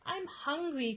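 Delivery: cried. Acted. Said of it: "cried Cyril".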